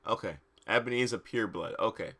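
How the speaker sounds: ordinary delivery, nothing marked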